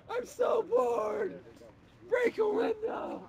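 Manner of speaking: high, very slow, loud bored yell; mock rioter shout. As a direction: holler it like a restless rioter. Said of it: "People would just be in the streets".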